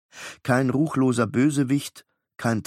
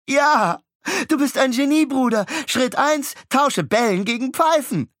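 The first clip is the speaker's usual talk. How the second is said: put-on much higher, louder gleeful boy; excited little boy; 14 semitones higher, 5 dB louder than usual. Beckett praising his brother, high gleeful boy. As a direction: bounce with delight at the idea.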